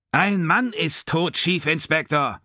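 very high, slow, loud bellow; furious roar. A narrator's reading. Sinclair leaping up at the unmoved inspector, furious roar. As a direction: roar it across the table. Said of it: "brüllte er".

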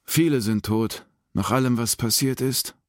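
ordinary delivery, nothing marked

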